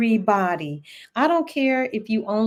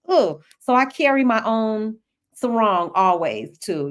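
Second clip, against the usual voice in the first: normal talking voice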